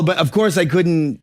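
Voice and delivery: ordinary delivery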